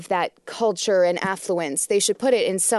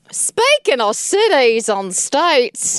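loud, slow, drawn-out Australian accent; hammed-up Australian twang, stretched out slowly; bad Australian accent; 4 semitones higher, 6 dB louder, much slower than usual. Shown as a demonstration. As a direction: exaggerate a broad Australian accent, loudly. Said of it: "I did it with an accent".

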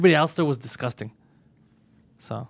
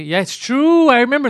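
higher and louder agreement; excited; 9 semitones higher, 8 dB louder than usual. Real, not acted. Friend suddenly recalling the story, excited. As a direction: burst out remembering.

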